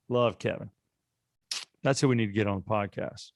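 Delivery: normal talking voice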